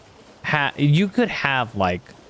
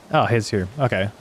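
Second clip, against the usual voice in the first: usual speaking voice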